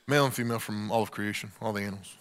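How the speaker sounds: embarrassed mumble. Retold or imitated. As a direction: mumble it so they won't hear.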